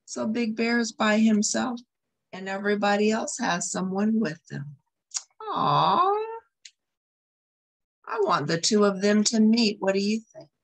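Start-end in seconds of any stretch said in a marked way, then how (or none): 5.4-6.1 sympathetic aw. Real, not acted. Reader reacts to lonely bear.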